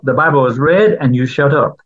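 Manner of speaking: ordinary talk, nothing marked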